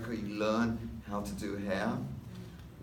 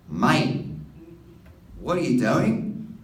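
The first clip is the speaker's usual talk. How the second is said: louder, higher Australian barber's call; Australian bloke; 5 semitones higher, 8 dB louder, slower than usual. Retold or imitated. Australian barber quizzing the young customer, loud Aussie twang. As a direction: hail him like an Aussie mate.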